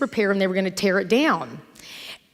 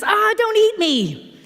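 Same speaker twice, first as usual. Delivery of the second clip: high, slow mock terror; hammed-up frightened cry; panicked plea; 12 semitones higher, 3 dB louder, slower than usual. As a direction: squeal it like a man facing a lion. Panicked man in gorilla suit.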